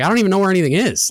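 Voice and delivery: high, fast, flustered protest. Retold or imitated. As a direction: blurt it out in a panic. Confused new cook protests.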